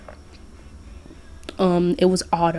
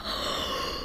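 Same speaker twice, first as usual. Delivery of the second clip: startled, soft gasp; sharp intake of surprise; 14 dB quieter than usual. A narrator's reading. Listener turning round to see Santa, startled gasp. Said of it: "you gasped".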